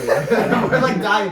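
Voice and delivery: high loud laughing; cracking up. Real, not acted. Laughing at the story.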